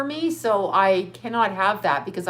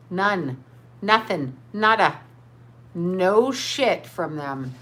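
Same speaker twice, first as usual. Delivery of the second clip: slow, lower emphatic; 4 semitones lower, much slower than usual. Real, not acted. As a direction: drag "No shit from them" out very slowly and lower. Insisting she took no money.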